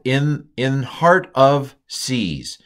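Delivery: slow deliberate reading of "in heart of seas"; slow literal gloss. Shown as a demonstration. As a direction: stress "heart" in a word-by-word gloss. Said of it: "It just says in heart of seas".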